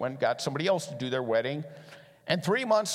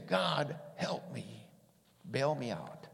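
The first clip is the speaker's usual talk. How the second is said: quiet plea; 2 semitones lower, 7 dB quieter, slower than usual. Acted. Runner praying for rescue.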